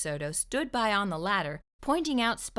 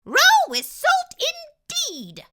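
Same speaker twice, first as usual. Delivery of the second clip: tiny squeaky dentist voice, high and slow; high mousy voice; 10 dB louder, slower than usual. Acted. Mouse dentist repeating the fox, high squeak.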